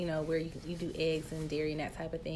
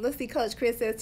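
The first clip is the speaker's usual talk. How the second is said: ordinary talk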